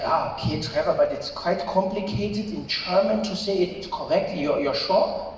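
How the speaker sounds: put-on doubtful German-accented English; Rolf. German friend doubting visitor can order, German accent. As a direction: hedge politely in a German accent.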